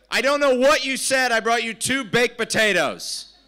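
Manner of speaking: loud, high, put-on server voice; clueless server. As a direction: announce the wrong order loudly. Acts out server bringing wrong food.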